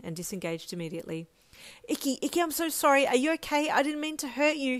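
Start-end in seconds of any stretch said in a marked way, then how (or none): 1.9-4.8 high, loud panicked apology; frantic with worry; distraught. A narrator's reading. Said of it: "he cried".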